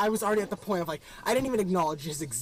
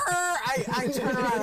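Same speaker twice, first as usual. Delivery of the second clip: ordinary talk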